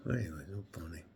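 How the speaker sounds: a soft breathy whisper; whispered aside. Retold or imitated. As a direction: murmur it under your breath. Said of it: "And he goes".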